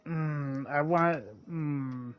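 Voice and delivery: ordinary delivery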